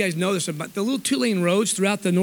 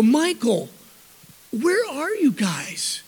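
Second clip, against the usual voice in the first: calling out; 5 semitones higher, much slower than usual. Retold or imitated. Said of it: "I go".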